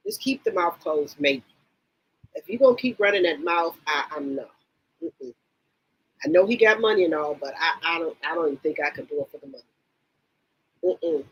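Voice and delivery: ordinary delivery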